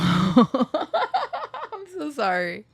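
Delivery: slow, high apology broken by laughter; laughing through tears. Real, not acted. Vlogger overcome while singing, laughing apology. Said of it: "I'm too emotional".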